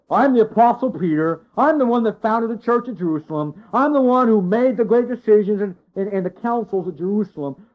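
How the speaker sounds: hammed-up bragging, pushing each claim; imagined self-important boast. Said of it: "he could have said".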